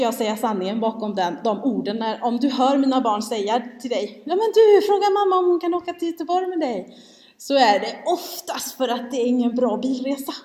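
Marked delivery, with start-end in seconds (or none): 4.3-7.0 put-on higher, louder quote of an eager child; lightly voiced child. Children urging a guest to ask their mamma, lightly mimicked higher voice. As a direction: lightly imitate a kid addressing someone.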